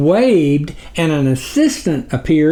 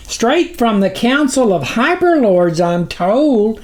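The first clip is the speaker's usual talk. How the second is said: squeaky, lightly voiced small figure; small figure's high anxious cry; high little cry; 8 semitones higher than usual. A narrator's reading. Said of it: "the small figure who'd spoken earlier cried".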